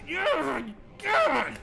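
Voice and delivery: high, strained groan of frustration; frustrated groan. Acted. Streamer acting out sitting there stuck, frustrated groan. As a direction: groan it out through rising exasperation. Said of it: "Being frustrated".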